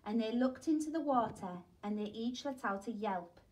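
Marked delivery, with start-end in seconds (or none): none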